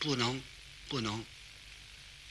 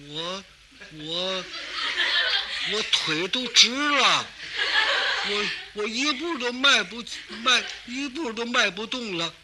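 shaky, slow, higher complaint; mock trembling; 4 semitones higher than usual. Narrator's friend frozen on the stairs, mock trembling and higher. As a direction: play a man too scared to take a step. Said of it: "哆嗦".

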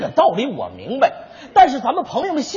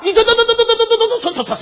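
outraged stammer; 9 semitones higher, much faster than usual. Real, not acted.

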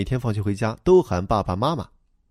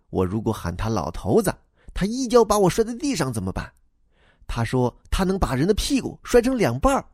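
put-on high, childlike voice for the little boy; young boy replying to the grown-up, high and eager; boy's voice; 8 semitones higher than usual. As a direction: play the earnest schoolboy explaining himself. Boy talks about his father.

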